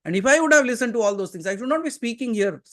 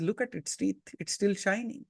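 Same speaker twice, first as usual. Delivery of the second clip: calm Krishna; 9 dB quieter than usual. Retold or imitated. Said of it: "Krishna says".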